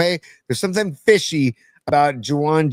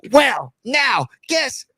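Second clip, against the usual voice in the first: robot voice; 10 semitones higher, much slower than usual. Pretend. Robot voice lyric.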